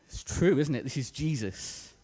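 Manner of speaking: usual speaking voice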